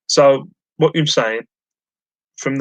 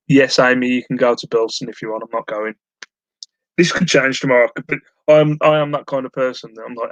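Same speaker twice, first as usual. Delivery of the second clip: normal talking voice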